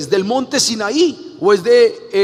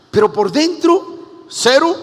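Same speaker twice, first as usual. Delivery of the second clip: rabia contenida; 6 semitones higher, 4 dB louder, slower than usual. Retold or imitated. Rabia por dentro.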